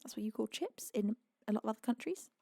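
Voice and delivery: ordinary delivery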